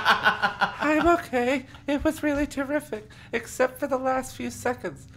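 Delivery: put-on high-pitched girl character; reassuring girl. A narrator's reading. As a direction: reassure them in a high little voice. Voices Aaron in the story.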